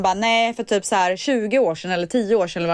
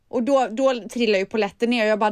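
ordinary talk

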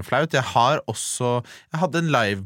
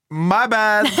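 mock my bad; 9 semitones higher, 5 dB louder than usual. Mock apology, social exit.

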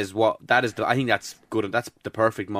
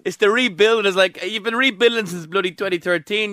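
laughing; 7 semitones higher than usual. Real, not acted. Laughing rant about rebuilding.

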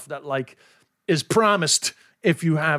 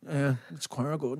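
slower, softer, a broad Wexford yeah it's choir good; Wexford impression; 9 dB quieter, slower than usual. Host praises his impression, he gives more Wexford accent. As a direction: lean into the Wexford vowels and let it sink. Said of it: "very good impression".

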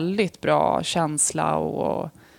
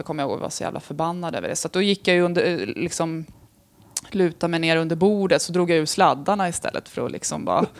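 ordinary talk, nothing marked

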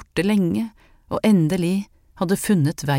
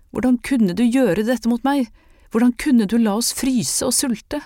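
bitter accusation; 6 semitones higher than usual. Acted. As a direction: hurl the hurt at him. She accuses Herman of abandoning them.

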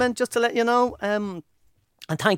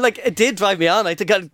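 usual speaking voice

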